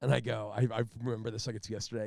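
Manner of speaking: normal talking voice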